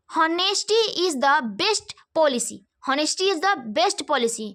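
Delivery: very slow, word by word; slow dictation. Shown as a demonstration. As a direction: read it out slowly for students to follow. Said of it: "teacher reads the example sentence". (बहुत धीरे, शब्द-शब्द बोलकर)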